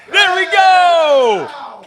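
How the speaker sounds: long, drawn-out celebratory shout; excited shout. Real, not acted. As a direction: cheer the play, stretching it out. Big play during game.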